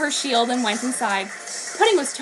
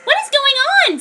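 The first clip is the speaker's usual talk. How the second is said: very high, louder alarmed cry; high girl voice; 6 dB louder than usual. Acted. Pudding, as the roof collapses, cries out high. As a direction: shout it as the ground shakes.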